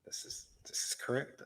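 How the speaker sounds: breathy mock-solemn whisper. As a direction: whisper it like a secret.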